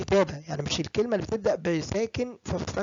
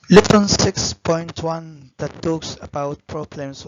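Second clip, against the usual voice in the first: loud announcement; 9 dB louder, much slower than usual. Real, not acted. Announcing the lesson.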